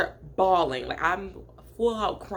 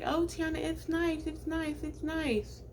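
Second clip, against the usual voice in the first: much higher and softer, gentle coaxing; 9 semitones higher, 6 dB quieter, slower than usual. Retold or imitated. Family coaxes Tiana in new dorm, soft high coaxing. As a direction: coax her softly, way up high. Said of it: "they're like".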